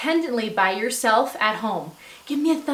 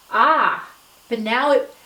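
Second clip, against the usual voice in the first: normal talking voice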